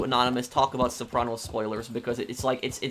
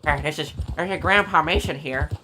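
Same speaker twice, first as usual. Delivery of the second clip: lightly voiced old grandpa, a bit higher; slightly higher, creaky old man pretending for scammers; 4 semitones higher, 3 dB louder than usual. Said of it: "can you do the grandpa voice?".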